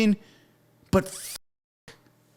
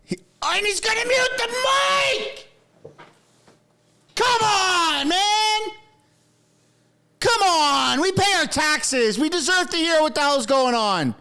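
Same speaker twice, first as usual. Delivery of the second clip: real frustrated shouts at the screen, pitched up; outraged yelling; 5 semitones higher, slower than usual. Real, not acted. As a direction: yell at the stream in disbelief. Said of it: "I'm sorry for yelling".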